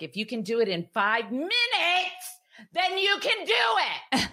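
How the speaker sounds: high-pitched mom snap, backed off the mic; re-enacted frustrated yell. Frustrated mother to dawdling daughter, re-enacted yell. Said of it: "this is what I did. I'm gonna back away from the mic".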